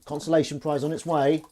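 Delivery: ordinary delivery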